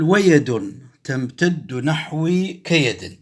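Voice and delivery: slow, drawn-out recital of the line; slow recitation. Shown as a demonstration. Teacher reciting a poem line slowly to scan it. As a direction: recite ويد تمتد نحوي كيد slowly, stretching each word. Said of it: "عندنا بيت آخر بنفس القصيدة".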